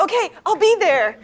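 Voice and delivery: high, loud, eager shout; calling back. Acted. New hire calls to editor while leaving, eager shout. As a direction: call it back on your way out.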